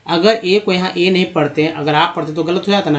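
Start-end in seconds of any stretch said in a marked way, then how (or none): none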